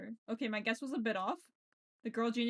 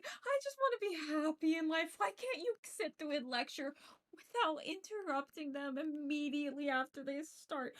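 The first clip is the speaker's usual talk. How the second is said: higher, slow, hammed-up fake sob; mock crying; 6 semitones higher, slower than usual. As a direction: wail it like a melodramatic crybaby. Fake sobbing at objection interrupting lecture.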